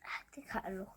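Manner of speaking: soft unvoiced whisper. Real, not acted. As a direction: breathe the words, no voice. Child whispers during homework. (همس)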